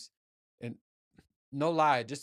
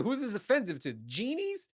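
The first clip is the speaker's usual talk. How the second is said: mock disbelief; 9 semitones higher than usual. Mocking reaction to the offence remark.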